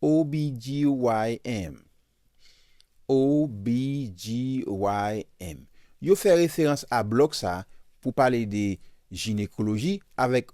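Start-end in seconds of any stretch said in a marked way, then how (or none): 0.0-5.6 slow, letter-by-letter spelling; O B G Y N, each letter given slowly and distinctly; spelled out slowly. Shown as a demonstration. Teacher spelling the abbreviation out slowly for her Haitian class. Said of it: "teacher spelling out term for students".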